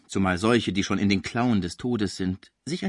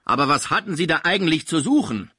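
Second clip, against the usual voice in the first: impatient question; 7 semitones higher, 4 dB louder than usual. A narrator's reading. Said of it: "fragte zum dritten Mal der Kranke".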